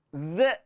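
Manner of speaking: "Z" as a voiced th with a stray short vowel; bouncing echo sound. Shown as a demonstration. Teacher showing the error, "Z" with an added echo vowel. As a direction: let "Z" bounce off the tongue. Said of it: "bunyi macam mana beranak".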